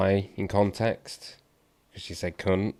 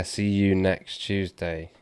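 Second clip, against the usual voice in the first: slowly puzzling the phrase out; slow repeat; much slower than usual. Retold or imitated. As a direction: say it slowly, word by word, as if decoding it. Decoding a misheard quote.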